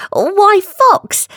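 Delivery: very high, mock-surprised mouse; exaggerated storybook hello; mouse's greeting. A narrator's reading. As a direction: greet him in a high, piping voice.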